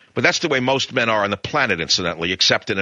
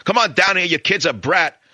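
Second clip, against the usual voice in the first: higher, louder mock shout from below; retold yell; 9 semitones higher, 3 dB louder than usual. Neighbor yelling up at the father from downstairs, mock shout. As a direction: call it up the stairs like an angry neighbor. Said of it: "someone screams up".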